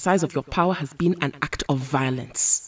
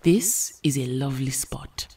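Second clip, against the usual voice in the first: soft breathy whisper; 4 semitones lower, 5 dB quieter than usual. Acted. Storm whispering to Rogue under the tree. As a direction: hush it, just for her.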